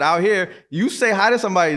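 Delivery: ordinary talk, nothing marked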